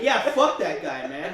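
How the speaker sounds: ordinary talk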